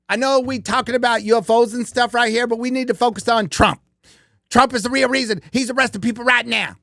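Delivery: mocking the woman who went off on Trump; imitating the woman. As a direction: parody her rambling Trump rant. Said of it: "Great Cartman impression".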